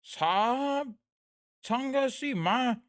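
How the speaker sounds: high, very slow, loud oratorical delivery; Modi impression. Chat demands Modi, high slow speechmaker voice. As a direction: stretch it out like a rally speech to the nation. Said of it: "That's my Modi impression".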